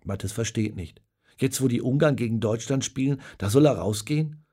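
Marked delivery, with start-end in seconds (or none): none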